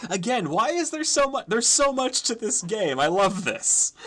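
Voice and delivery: high, loud gushing; real excitement. Real, not acted. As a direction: burst out with delighted amazement. Player delighted by the game.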